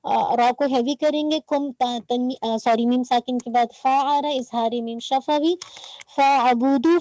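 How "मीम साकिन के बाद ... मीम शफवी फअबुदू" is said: ordinary delivery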